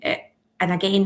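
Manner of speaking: ordinary delivery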